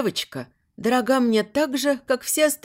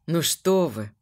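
flustered mumble; 4 semitones lower, slower than usual. A narrator's reading. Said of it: "растерянно пробормотал граф Шеррес".

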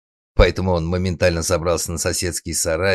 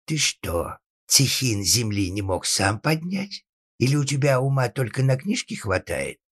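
rising, higher incredulous rebuke; exasperated scolding; 8 semitones higher than usual. Acted. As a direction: chide the clever son, stretching the words. Father berates his clever son.

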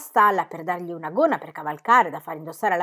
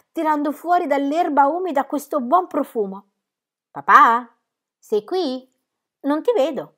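put-on higher, louder excited child; happy girl; 6 semitones higher, 6 dB louder than usual. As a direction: burst out with joy. Molly riding in the dark.

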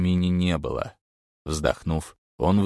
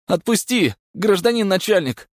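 higher, louder wheezing plea; hoarse cry; 14 semitones higher, 6 dB louder than usual. A narrator's reading. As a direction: rasp out a desperate plea. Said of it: "прохрипел мужчина".

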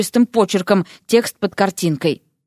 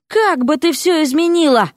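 higher, indignant challenge; trembling with anger; 6 semitones higher than usual. A narrator's reading. Erik challenging his wife, trembling with anger. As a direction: demand an answer, angrily. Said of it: "дрожащим от злости голосом".

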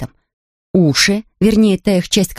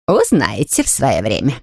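lower, secretive housekeeper; mysterious Mrs Bird; 6 semitones lower than usual. Acted. Housekeeper teases the curious family, mildly mysterious. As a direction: keep it a secret, mildly teasing. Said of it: "проговорила она загадочно".

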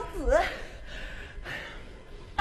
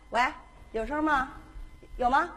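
ordinary talk

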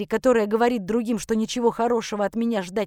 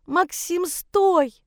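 high cry rising to a shout; distraught wail; 9 semitones higher, 3 dB louder, much slower than usual. Acted. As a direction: cry after him, loud on the last word. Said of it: "За спиной рыдала мать". (отчаянно кричит, высоким голосом)

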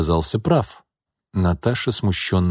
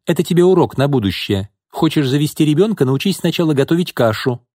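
higher-pitched, firm telling-off; curt scolding; 8 semitones higher, 3 dB louder than usual. A narrator's reading. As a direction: teach them a lesson, no nonsense. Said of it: "отрезала Марина". (строго, назидательно)

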